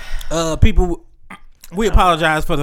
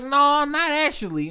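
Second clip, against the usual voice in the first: higher, lightly voiced friend; mock friend voice; 8 semitones higher than usual. Retelling a friend's words, in a higher mock voice. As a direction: play the friend a bit higher. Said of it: "she was like".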